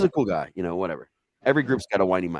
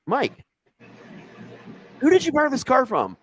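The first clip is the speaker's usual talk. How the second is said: pitched way up, dazed and innocent; high stoned voice; 3 dB louder than usual. Retold or imitated. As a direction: ask it in a high, spaced-out voice. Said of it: "I'm like".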